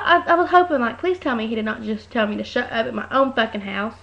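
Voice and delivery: ordinary delivery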